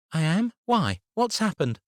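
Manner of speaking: put-on high child's voice, worried; a little girl fretting about school; anxious young girl. A narrator's reading. Abby voiced by narrator.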